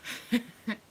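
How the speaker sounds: short high laugh; real amused laugh. Real, not acted. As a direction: break into a laugh. Laughs at own line.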